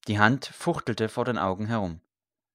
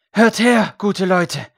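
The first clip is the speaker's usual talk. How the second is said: high, loud call to a crowd; 8 semitones higher, 10 dB louder, slower than usual. A narrator's reading. Priest calls the people to listen, raised shout. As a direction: shout for everyone's attention. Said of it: "rief er".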